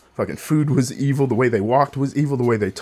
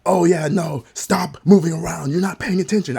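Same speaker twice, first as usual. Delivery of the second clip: higher, sharper scolding; scolding teacher; 5 semitones higher, 4 dB louder than usual. Retold or imitated. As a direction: mimic the teacher telling him off. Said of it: "she's like".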